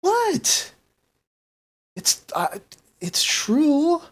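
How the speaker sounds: very high-pitched, unabashed; mock-innocent protest. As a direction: play innocent when scolded, pitch shooting up. Gram protests granddaughter's scolding.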